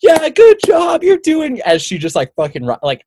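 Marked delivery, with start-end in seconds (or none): 0.0-1.6 high mock cheer from the sidelines; cheering shout. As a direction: yell encouragement to the racer. Mom cheering at race.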